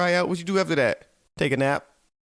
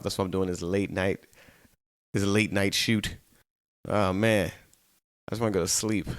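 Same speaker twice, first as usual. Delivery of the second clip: ordinary delivery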